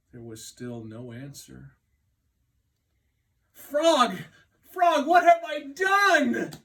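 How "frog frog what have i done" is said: high, loud despairing cry; anguished cry. Acted. Toad crying out for vanished Frog, high and loud. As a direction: cry out in guilt. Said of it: "cried Toad".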